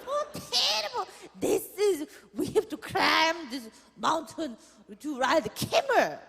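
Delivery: very high, half-crying mock mother; whiny mom impression. Mom moaning about her worst vacation, hammed-up crying. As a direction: wail like a wounded diva mom.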